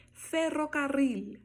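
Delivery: FERROCARRIL high and slow with two long trills, clear final L; double rolled RR. Shown as a demonstration. Teacher seeing if learners manage it, rolled ferrocarril. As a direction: trill both RRs of ferrocarril. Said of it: "duas vezes o R R".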